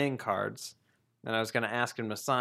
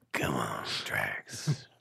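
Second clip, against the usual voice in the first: very low gravelly whisper; [name] voice; 13 semitones lower, 6 dB quieter, slower than usual. Pretend. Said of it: "[name] playing Thomas".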